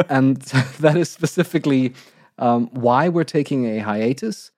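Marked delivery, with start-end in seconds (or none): none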